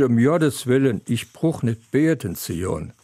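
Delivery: normal talking voice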